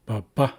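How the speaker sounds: "ba ba" with emphasis on the second "ba"; light first "ba", stronger second "ba"; second-syllable stress. Shown as a demonstration. Teacher showing the accent marker, "ba ba" stressed on the second. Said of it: "so we know we place emphasis there".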